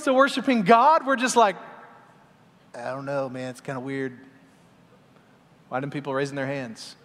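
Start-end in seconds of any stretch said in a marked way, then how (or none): 2.7-6.9 low, quiet, doubtful mumble; reluctant worshiper. Retold or imitated. Half-hearted churchgoer questioning raised hands, reluctant. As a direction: drop low and soft, unconvinced.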